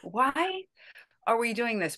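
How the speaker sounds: high, puzzled mock disbelief; baffled. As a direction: ask it like you can't believe the script. First reaction to the script.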